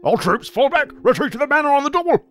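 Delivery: very high, loud order to retreat; commanding shout. Acted. Goblin chief ordering his troops to fall back.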